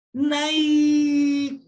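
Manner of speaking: NAIK shouted high and held long; long gleeful shout. Shown as a demonstration. Lecturer demonstrates jumping with glee, long high shouted naik. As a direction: cheer out a drawn-out NAIK as if jumping for joy. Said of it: "tapi intonasi yang panjang".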